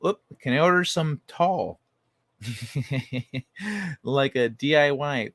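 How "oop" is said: usual speaking voice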